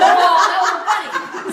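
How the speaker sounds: slow, drawn-out oh through laughter; host laughing off an awkward moment; relieved laugh. Retold or imitated. Relieved reaction to the joke.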